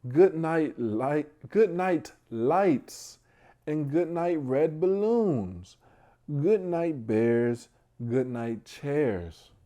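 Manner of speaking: drawing out each rhyming line; bedtime story reading. Acted. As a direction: read it out like a picture book for little ones. Reads bedtime story aloud.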